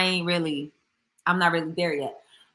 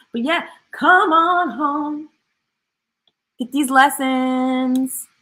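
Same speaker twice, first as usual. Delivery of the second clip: playful drawn-out call; 6 semitones higher, 7 dB louder, much slower than usual. Pretend. Sing-song sign-off to viewers.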